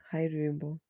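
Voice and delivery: ordinary delivery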